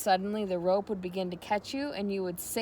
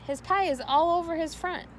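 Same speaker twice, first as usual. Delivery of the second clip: lightly voiced complaining girl; high-pitched girl's shout; 9 semitones higher than usual. A narrator's reading. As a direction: Fern calling out, a touch shrill-free and high. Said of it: "shouted Fern".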